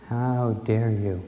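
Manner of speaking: slow, deliberate indignant repeat; slow, weighted furious echo; fury, slowed. Retold or imitated. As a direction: draw out each word in retold fury. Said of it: "I sat down in fury".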